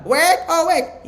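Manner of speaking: high, loud, drawn-out squawk; comic squawk of someone at home; mock household intonation. Preacher mimics a household voice mistaken for God, high mock squawk. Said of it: "intonasi orang rumah".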